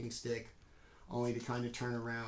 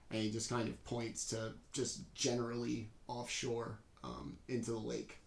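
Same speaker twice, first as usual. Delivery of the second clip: normal talking voice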